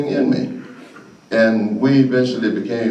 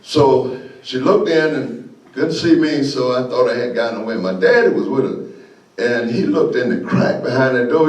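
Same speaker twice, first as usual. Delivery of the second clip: ordinary talk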